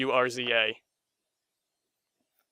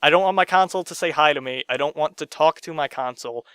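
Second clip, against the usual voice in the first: ordinary talk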